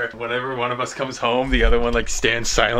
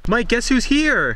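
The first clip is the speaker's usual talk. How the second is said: higher, louder, slow sing-song call; excited calling; 11 semitones higher, 6 dB louder, slower than usual. Real, not acted. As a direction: announce the surprise gleefully. Calling out to brother.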